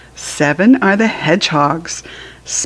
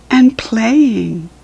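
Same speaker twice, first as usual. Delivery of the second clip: usual speaking voice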